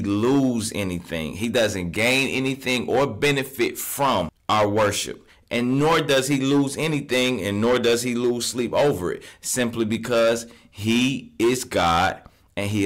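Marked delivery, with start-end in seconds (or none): none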